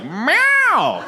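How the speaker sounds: high, drawn-out imitated meow; exaggerated cat cry; loud recorded meow. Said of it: "a loud recording goes off".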